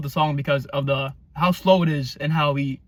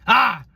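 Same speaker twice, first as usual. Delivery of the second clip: mock scream; 7 semitones higher, 5 dB louder than usual. Mock scream after song quote.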